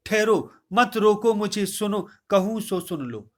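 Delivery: loud, high cry to stop the court; urgent command. Acted. Kaikeyi cries out to the assembly to hear her, loud. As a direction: halt everyone with a loud appeal.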